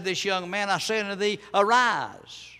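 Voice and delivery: high, loud preacher's command; loud rising cry to the dead; commanding call. Acted. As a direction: call the dead boy up to rise. Jesus commands the dead boy.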